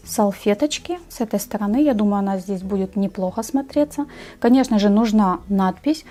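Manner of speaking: ordinary talk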